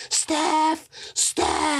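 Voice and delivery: high-pitched mock scream of a name; retold scream. Teasing his friend for screaming at a basketball star, mock scream. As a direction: imitate a drunk friend yelling out, pitch way up. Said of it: "Mark just screams out".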